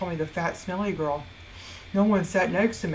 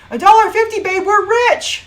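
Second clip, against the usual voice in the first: high, loud mock-jubilant shout; over-the-top celebration, stretched at the end; lottery-winner excitement; 13 semitones higher, 11 dB louder than usual. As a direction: shriek the news like a jackpot win. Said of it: "like, I just won the lottery".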